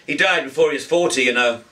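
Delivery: lightly voiced chatty young man, quick; a hint of a brisk young man, talking fast; young man's voice. A narrator's reading.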